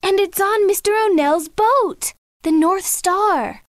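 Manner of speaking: put-on high girl's voice, slowing on the name; exclaiming. A narrator's reading. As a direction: reveal it dramatically in high pitch. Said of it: "exclaimed Michelle".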